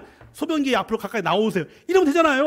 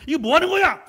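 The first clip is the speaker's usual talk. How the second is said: higher, louder angry yell; mock angry outburst; angry shout; 7 semitones higher, 3 dB louder than usual. Shown as a demonstration. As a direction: snap at them to show anger. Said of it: "소리를 쳐야죠".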